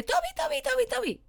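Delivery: high-pitched excited calling of the dog. Shown as a demonstration. What not to do: owner calling the dog excitedly. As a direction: call the dog's name in a shrill overexcited rush. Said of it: "lo que no tenéis que hacer es decirle".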